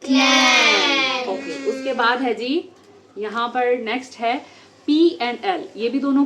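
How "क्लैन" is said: "क्लैन" drawn out slowly and loud; stretched loud word. Shown as a demonstration. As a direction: sound out "क्लैन" for the class. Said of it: "this is".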